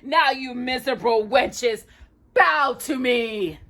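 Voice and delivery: high, slow sneering command; sneering villain. Acted. Jafar orders the captives to bow, slow sneering villain. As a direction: drawl the order like a gloating sorcerer.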